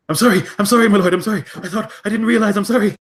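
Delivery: high, loud, panicked begging; terrified apology. Acted. Boy begging the prince's pardon, high panicked pleading. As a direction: grovel in panic, voice way up. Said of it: "he looks horrified".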